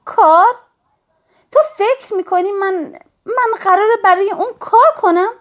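high, loud, incredulous outburst; loud scoffing. A narrator's reading. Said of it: "با صدای بلند خندید و گفت". (با ناباوری، بلند و تمسخرآمیز)

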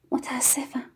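near-whispered, very soft; hushed apology. A narrator's reading. Yann apologises to Tiri before leaving, hushed apology. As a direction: apologise under the breath.

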